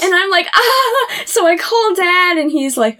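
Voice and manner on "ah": high panicked ah; retold panicked yelp. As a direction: yelp like the door won't open. Retold panic, washer locked.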